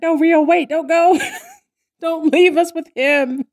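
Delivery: high, loud, hammed-up plea; mock pleading. Hosts to a departing character, mock pleading.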